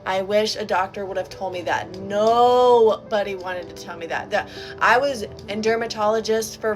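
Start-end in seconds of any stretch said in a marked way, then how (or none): none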